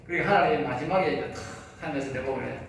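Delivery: ordinary talk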